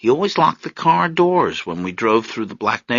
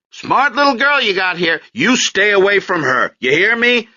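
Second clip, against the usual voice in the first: higher, louder threat; father's higher, louder threat to the man; angry warning; 11 semitones higher, 5 dB louder than usual. Acted. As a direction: warn the man off his daughter.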